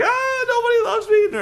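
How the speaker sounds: very high, hammed-up crying wail; mock wail. Vicky falling off the beam and running off, a hammed-up high wail. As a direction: wail it like a sulky kid running off. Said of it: "she starts to cry".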